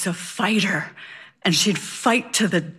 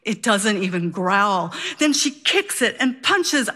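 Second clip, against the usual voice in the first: normal talking voice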